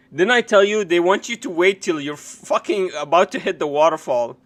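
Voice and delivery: ordinary talk, nothing marked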